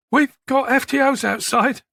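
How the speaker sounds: put-on high, nervous young officer; shaky. A narrator's reading. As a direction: warn the suspect while rattled. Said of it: "said Warren shakily".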